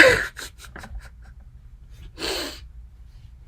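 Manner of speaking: a high, breathy burst of laughter; real laugh at being overwhelmed. Real, not acted. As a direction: laugh at yourself, high and airy. Laughs at own distress.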